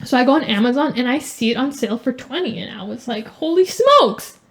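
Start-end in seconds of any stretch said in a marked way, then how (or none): 3.3-4.4 amazed. Retold or imitated. Retelling finding the planner on sale.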